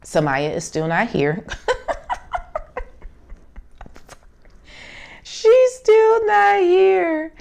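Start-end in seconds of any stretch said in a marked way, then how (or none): 1.5-2.8 airy, high laugh; a quick silent giggle; high breathless laugh. Real, not acted. Laughing to viewers that her daughter was still not here, a breathy high giggle. 5.2-7.3 much higher, slow, through laughter; laughing. Real, not acted. Telling viewers her baby was still not born, much higher and slow through laughter. Laughing at daughter's late arrival.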